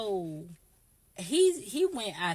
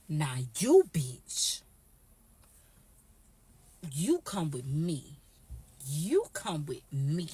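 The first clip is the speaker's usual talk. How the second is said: retold stern order; 3 semitones lower, 3 dB louder, slower than usual. Man turns, orders the teller along.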